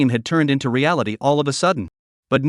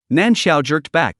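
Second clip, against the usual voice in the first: ordinary delivery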